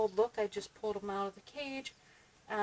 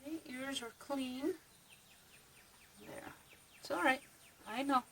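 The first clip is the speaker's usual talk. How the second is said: soothing a rabbit; 3 semitones higher, 6 dB quieter than usual. Real, not acted.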